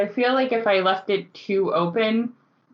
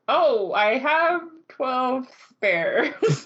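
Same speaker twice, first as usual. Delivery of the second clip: slow, higher-pitched, laughing delivery; amused mimicry; 5 semitones higher, much slower than usual. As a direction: draw it out, giggling as the barmaid. Mimicking barmaid's callous answer, amused.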